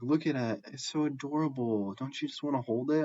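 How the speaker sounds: high, sing-song coaxing over the bunny; cooing sister. Retold or imitated. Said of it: "she's like".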